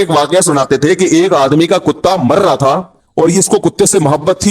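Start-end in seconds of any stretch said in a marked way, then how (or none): none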